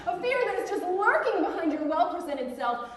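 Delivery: ordinary talk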